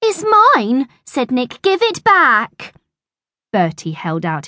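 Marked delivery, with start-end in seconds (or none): 0.0-0.9 put-on high, loud childish claim; high-pitched playground indignation; indignant protest. A narrator's reading. Nick demands his paper back. 1.6-2.7 put-on high, loud, drawn-out childish whine; slow, high-pitched playground indignation; indignant demand. A narrator's reading. Nick demands his paper back.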